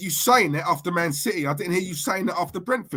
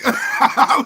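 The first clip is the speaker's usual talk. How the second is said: high, loud burst of laughter; 8 semitones higher, 8 dB louder than usual. Real, not acted. Laughing at the played clip.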